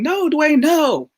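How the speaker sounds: high, loud retold yell. Re-enacting yelling at twin brother from the Special Olympics stands, retold yell. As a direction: call out high and drawn out, imitating a yell. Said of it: "like, yelling, like".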